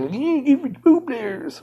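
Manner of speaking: high, slow silly whine; mock them in a squeaky whine; made-up mocking voice. Streamer mocks liars in a made-up voice. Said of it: "This voice I made up".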